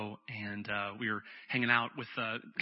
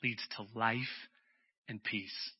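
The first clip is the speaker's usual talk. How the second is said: life held long and falling gently; stress on life; 4 semitones lower, 6 dB quieter than usual. Shown as a demonstration. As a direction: let life ring out, then soften into peace. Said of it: "but letting the Spirit".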